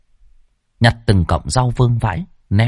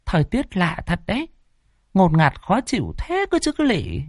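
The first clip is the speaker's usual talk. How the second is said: put-on uneasy old woman; 9 semitones higher, 4 dB quieter than usual. A narrator's reading. Old woman grumbles about weather.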